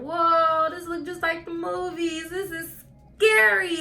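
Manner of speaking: high, loud gasp of awe turning scared; wide-eyed at the operating room; awed fright. Retold or imitated. Wheeled into surgery, she gasps in mock fright.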